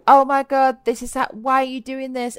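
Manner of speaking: retold frustration, blurted out; reliving annoyance at her friend; frustrated outburst. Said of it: "I felt frustrated".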